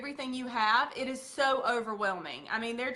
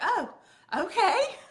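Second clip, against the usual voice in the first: much higher, laughing relieved "oh okay"; 11 semitones higher, 6 dB louder, slower than usual. Retold or imitated. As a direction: let the tension drop with a laugh. Relieved customer.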